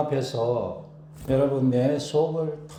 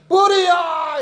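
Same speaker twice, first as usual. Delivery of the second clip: "불이야" shouted much higher and louder; fire shout; 14 semitones higher, 9 dB louder, much slower than usual. Shown as a demonstration. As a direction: yell for help. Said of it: "이래야지". (다급하게 "불이야!")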